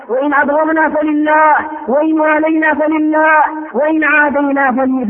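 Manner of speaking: ordinary delivery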